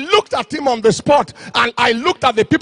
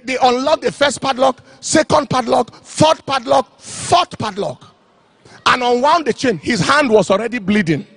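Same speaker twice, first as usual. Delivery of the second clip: ordinary talk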